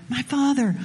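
a hint of a humble servant, a little fuller and pleading; lightly voiced servant, leaning in to persuade; respectful servant. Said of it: "you see how the servants approached those they serve?".

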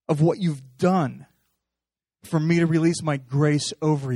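loud, rising preaching; fervent loud sermon; preaching at full force. Acted. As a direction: drive it home from the pulpit.

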